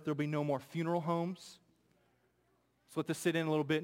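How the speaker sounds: ordinary talk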